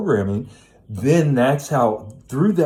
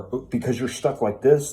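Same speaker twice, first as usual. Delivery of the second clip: usual speaking voice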